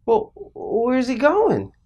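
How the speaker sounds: put-on slow, high, worried kid's question; shocked child. Child asking dad about his tree, acted anxious dread. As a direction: draw it out high, dreading the answer. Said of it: "I'm shocked".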